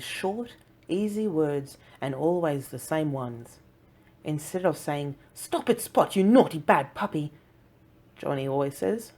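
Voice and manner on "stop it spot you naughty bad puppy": mock scolding. Contrasted scolding example.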